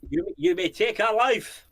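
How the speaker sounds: rally the troops before battle, slowly; a Scottish warrior's freedom speech, hammed up; mock Braveheart speech.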